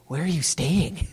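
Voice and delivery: soft, slow, creepy question; mock creepy disciples. Two disciples following Jesus, softly creepy question. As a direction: ask it slowly and softly like a stalker. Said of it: "They're like".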